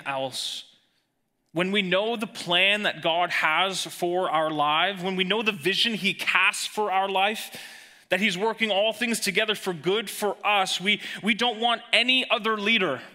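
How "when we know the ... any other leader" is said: building, higher and louder preacher's cry; preaching at full force. Acted. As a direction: drive it home from the pulpit.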